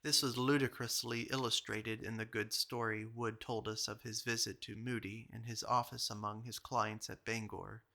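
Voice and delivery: usual speaking voice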